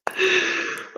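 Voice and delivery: high helpless giggle; a burst of giggles you can't hold in; real giggling fit. Real, not acted. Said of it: "I get the giggles and I can't keep a straight face".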